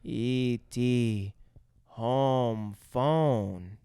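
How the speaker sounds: slow alien voice, words far apart. Retold or imitated. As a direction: intone each word like the alien. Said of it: "E T says the iconic lines".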